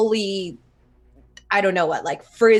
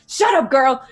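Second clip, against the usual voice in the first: loud, higher snapping command; sharp shout; 6 semitones higher, 7 dB louder than usual. Acted. Mentor silencing Zhao, loud sharp shout. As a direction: cut her off with a shout.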